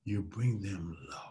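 slow, dropping, softened voice; bring them low. Shown as a demonstration. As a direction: ease downward, drawing out the words. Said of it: "If somebody's high".

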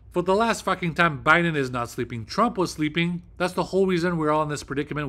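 loud, heated rant; real exasperation. Real, not acted. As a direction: push it out forcefully, fed up. Fed up, defending Biden angrily.

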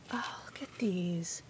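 hushed awed whisper. Real, not acted. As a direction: breathe it out in awe. Whispered awe at gift.

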